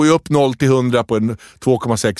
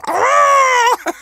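very high mock squeal; put-on high-pitched shriek; imitated girlish scream; 3 dB louder than usual. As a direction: squeal like the surprised girlfriend. Imitating girlfriend's reaction to ring.

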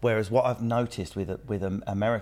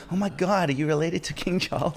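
higher, gushing starstruck American; awed American woman; 7 semitones higher than usual. Pretend. American woman gushes over royalty, higher-pitched. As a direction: gush like a starstruck fan. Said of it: "we were like".

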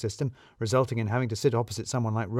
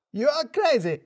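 put-on much higher, shouted outburst in a foreign-accented voice; Radu shouting; 13 semitones higher than usual. A narrator's reading. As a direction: explode at the boss behind the door. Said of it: "heard Radu shout".

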